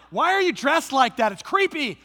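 loud, high, spooked and grossed out; creeped-out yell. Retold or imitated. Dad eyeing the orange snake, loud and creeped out. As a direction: recoil from it like it's menacing.

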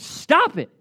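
voicing Paul scolding feuding Christians; Paul pleading. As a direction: shout at them to stop fighting. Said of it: "And Paul says".

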